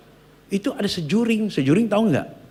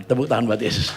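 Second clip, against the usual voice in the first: ordinary talk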